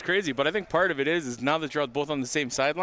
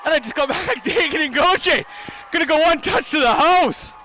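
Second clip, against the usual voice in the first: excited shout; 10 semitones higher, 6 dB louder than usual. Real, not acted. Touchdown call on the air.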